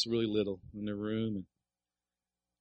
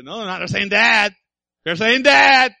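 higher, rising into a loud insistent wife's voice; wife's retort; 11 semitones higher, 15 dB louder, slower than usual. Retold or imitated. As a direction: she insists, louder each time. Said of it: "wife retorts".

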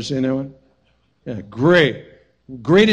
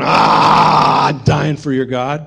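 loud mock cry of a fanatic; hammed-up shout of a zealot; 7 dB louder, much slower than usual. Mock suicide bomber cry.

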